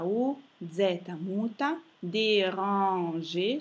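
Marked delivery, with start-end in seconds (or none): 2.0-3.6 "déranger" very slow, middle vowel nasal; nasal sound. Shown as a demonstration. Teacher demonstrates the infinitive, slow with nasal middle. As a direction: stretch "déranger" by syllable, nasal "ran", silent R at end. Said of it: "suono nasale".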